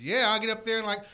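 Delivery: ordinary delivery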